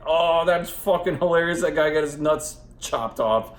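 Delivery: loud, high, hammed-up rowdy teen voice; cackling teens. Kids watching a slasher film, loud high mock delight. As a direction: shout it like a kid thrilled by gore. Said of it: "They're like".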